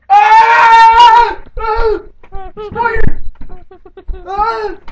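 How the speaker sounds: panicked shrieks; screaming at a spider. Pretend. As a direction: scream in mock terror.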